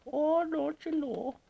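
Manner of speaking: put-on high, slow Upper Midwest accent on "don't you know"; northern accent. Teasing the northerners with a high, slow Midwest accent. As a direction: stretch it out like a mock Wisconsin local. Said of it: "That's for you northerners".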